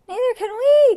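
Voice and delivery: very high, loud wail; squeaky, dismayed outcry; crying out. A narrator's reading. Peccaries who cannot climb trees, crying out. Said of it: "cried the pecaries".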